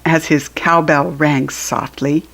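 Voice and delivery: plain reading voice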